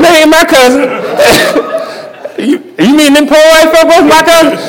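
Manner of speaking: high, laughing mock protest; laughing disbelief. Man laughing as he retells denying his cousins.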